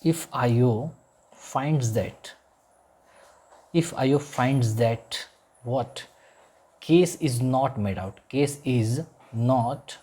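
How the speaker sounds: very slow, word by word dictation. Shown as a demonstration. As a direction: drag each word out so students can copy. Teacher dictating English phrase.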